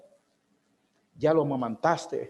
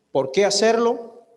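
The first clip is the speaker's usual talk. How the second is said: rhetorical call; 6 semitones higher, 6 dB louder, slower than usual. Acted. Rhetorical question to congregation.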